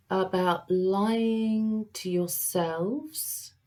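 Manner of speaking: ordinary delivery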